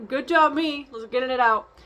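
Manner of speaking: pep yourself up through a laugh; giddy self-encouragement; laughing self-praise. Retold or imitated. Cheering herself on while crying.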